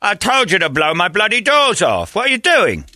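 high, hammed-up British actor parody. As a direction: push the English impression up very high.

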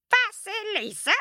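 very high shrieked name. Acted. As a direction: shriek it like a wicked stepmother. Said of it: "shrieked the stepmother".

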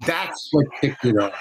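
usual speaking voice

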